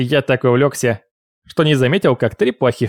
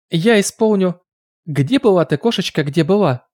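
put-on excited girl voice; 5 semitones higher than usual. Lucille announcing her song.

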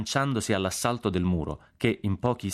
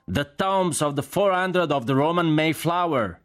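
high, slow, carrying shout; shouted answer; 10 semitones higher, 4 dB louder, slower than usual. A narrator's reading. Jack shouts explanation down the noisy column, shouted answer. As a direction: holler each phrase out long and high. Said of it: "gridò Jack".

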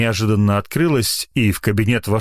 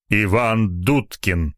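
usual speaking voice

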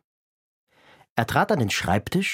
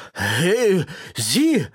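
higher, louder angry call; scolding shout; 6 semitones higher, 3 dB louder than usual. Acted. The constable scolds Hotzenplotz on his desk, angry and loud. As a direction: bark at the intruder on the desk. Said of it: "schimpfte Wachtmeister Dimpfelmoser".

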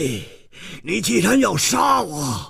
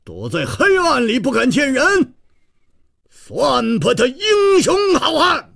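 stern shout; 14 semitones higher than usual. Acted. Hero challenging a hidden foe across the rooftops, stern high shout. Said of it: "厉声说".

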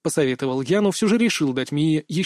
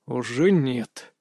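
low, slow, glum reply; gloomy; 4 semitones lower, much slower than usual. A narrator's reading. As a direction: answer gloomily, dragging the words. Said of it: "мрачно ответил я".

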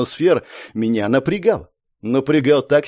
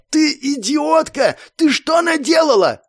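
furious yelling; 13 semitones higher than usual. A narrator's reading. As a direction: scream at her in rage. Furious mage yells at girl.

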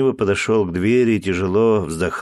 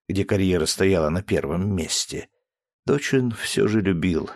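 plain reading voice

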